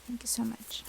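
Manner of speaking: whispering. Real, not acted. Whispered thanks to the spirits.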